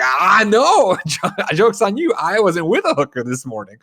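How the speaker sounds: high, loud gloating mock voice; Ben Affleck. Actor bragging back to his co-star, hammed-up and high. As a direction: crow it like a cocky actor, hammed-up. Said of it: "He's like".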